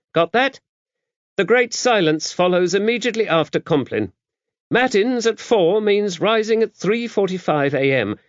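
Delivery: high, brisk abbess rattling off prayer times; rehearsed recital. A narrator's reading. Dame Tabitha reciting the prayer hours to Max, rehearsed and brisk. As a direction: reel off the schedule like a drill. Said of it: "a much-rehearsed speech".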